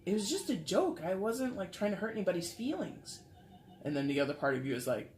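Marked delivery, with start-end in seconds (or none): 0.1-3.2 higher-pitched defensive protest, acted; mildly sheepish, raised-pitch self-defence; embarrassed excuse. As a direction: play someone excusing their own joke. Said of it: "feel a little bit ashamed or embarrassed".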